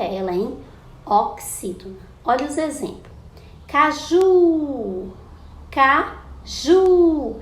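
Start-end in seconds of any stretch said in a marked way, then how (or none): 3.7-5.1 "Caju" called high and loud, JU held long; calling out the word. Shown as a demonstration. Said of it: "o ju é a sílaba tônica". 5.7-7.4 "caju" broken after CA, then a long loud JU; "caju" in two pieces, high drawn-out ending; calling out the word. Shown as a demonstration. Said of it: "o ju é a sílaba tônica".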